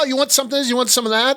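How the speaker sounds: high-pitched, brash counterman impression; deli loudmouth. Host playing the guy behind the deli counter, high loudmouth. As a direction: pester the customer like a loudmouth. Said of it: "You need a loudmouth behind the fucking deli".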